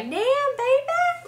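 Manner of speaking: put-on slow, very high "Damn, baby."; drawn-out high catchphrase. Shown as a demonstration. Teasing a friend about two dollars, slow high catchphrase. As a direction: draw it out, up in falsetto. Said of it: "Then I'll be like".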